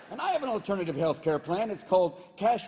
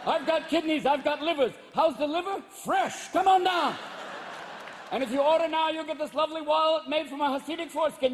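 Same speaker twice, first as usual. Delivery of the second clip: hammed-up huckster rabbi; 9 semitones higher than usual. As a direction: sell organs like a late-night ad.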